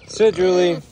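high, loud, repeated command; calling a command. Real, not acted. Keeper telling Julie the pig to sit, loud command. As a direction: call it out high and firm.

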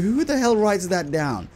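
ordinary talk

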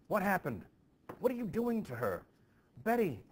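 high, loud frightened cries; panicked loud calling out; alarmed shout. Acted. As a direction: shout in alarm over the girl. Frightened parents crowd around Betty.

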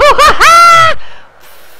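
loud shout breaking into a laugh. Real, not acted. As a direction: burst out yelling and laughing. Losing fight, big shout.

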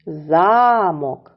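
Замок with a long, strong ЗА; teacher's drawn-out ZAA-mok; stress on first syllable. Shown as a demonstration. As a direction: hold the За of Замок, short мок. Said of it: "припадає на перший склад".

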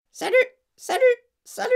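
very high squeaky calls; loud, clear parrot. A narrator's reading. Hidden parrot heard from the dining room, squawking. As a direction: ring out like a parrot. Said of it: "une voix forte et claire".

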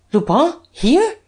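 high, rising, incredulous exclamation; astonished cry. A narrator's reading. Duke learning Lupin is already here, astonished cry. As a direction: cry it out as startled questions, pitch leaping. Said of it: "cried the Duke".

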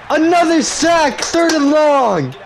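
high, stretched-out triumphant yell; exultant shout. Real, not acted. Gamer celebrating another sack, exultant shouting. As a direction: bellow the big play slowly, sky high.

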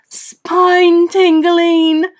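high, loud, drawn-out cry; startled blurt. A narrator's reading. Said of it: "blurted Mister and Missus Frankenstein between gasps".

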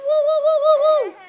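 very high, very loud whoops; cheering woo. Real, not acted. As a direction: whoop like a fan.

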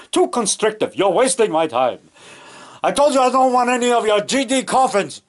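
imitating an irate old horror star; hammed-up annoyance at the coffin seller; Bela Lugosi. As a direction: build from grumble to high indignant complaint. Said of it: "where Bela Lugosi was getting his coffin".

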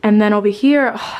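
usual speaking voice

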